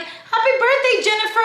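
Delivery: high, loud celebratory call-out; sing-song birthday shout; announcing. Retold or imitated. Staff shouting out Jennifer's fake birthday. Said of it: "they're like".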